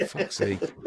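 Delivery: laughing. Real, not acted. Laughing at co-host.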